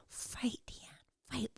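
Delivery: breathy whisper of an inner voice; faint whisper. Retold or imitated. Woman recalling her inner voice, whispering faintly. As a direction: whisper it faintly, urging herself on. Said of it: "a faint little voice in me saying".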